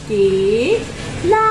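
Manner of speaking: केला said very slowly, syllable by syllable; slow kela. Shown as a demonstration. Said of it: "अब इसे दोबारा बोलो". (धीरे धीरे केला)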